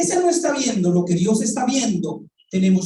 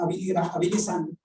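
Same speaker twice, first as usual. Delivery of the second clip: usual speaking voice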